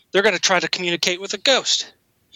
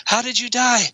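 higher-pitched mock questioning; lightly voiced ghost hunter; 6 semitones higher than usual. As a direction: call out to the spirit, playfully acted.

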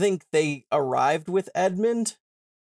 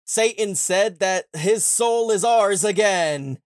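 triumphant announcement; 6 semitones higher, 4 dB louder, slower than usual. Retold or imitated. Aslan cheering with the others.

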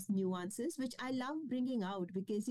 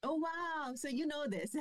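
higher-pitched, pleased "oh wow"; delighted surprise; 7 semitones higher than usual. Real, not acted. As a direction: react with happy surprise to a welcome connection. Learns the host's daughter teaches.

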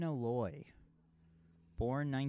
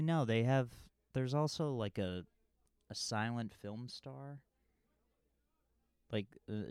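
normal talking voice

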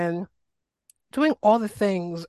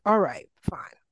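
a resigned little whisper, giving in; whispered resignation. Retold or imitated. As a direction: breathe it out, shrugging it off. Resigned reply to teacher's advice.

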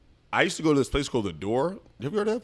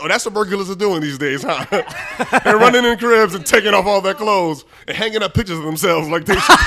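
loud, high, cracking up while talking; laughing incredulously; 8 semitones higher, 11 dB louder than usual. Real, not acted. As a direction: barely get the joke out through real laughter. Cracking up at own retort.

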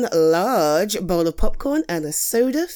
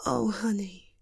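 whispering; 8 dB quieter than usual. Acted. Sympathy for friend.

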